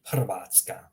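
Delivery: "Hrvatska" with throaty h and trilled r; rolled R. Shown as a demonstration. Teacher reminds learners, "Hrvatska" with rolled r. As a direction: roll the r in "Hrvatska". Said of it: "remember to roll the R's".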